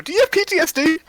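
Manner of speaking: high mock innocent question; blunt question voice. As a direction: ask it high with fake innocence. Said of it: "turn to Jade and just go".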